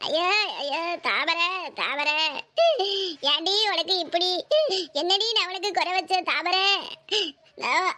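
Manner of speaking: low, slow, drawn-out keening; theatrical dirge with long sobbing pauses; wailing lament. Acted. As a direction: mourn your sister's name, dragging each word out. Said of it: "இப்படி கடந்து ஒப்பாரி வச்சுக்கிட்டு".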